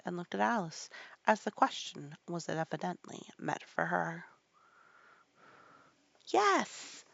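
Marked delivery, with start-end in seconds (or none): 6.3-7.0 high-pitched called answer; shouted reply. A narrator's reading. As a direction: call it out high. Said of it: "shouted Alice".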